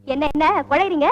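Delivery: usual speaking voice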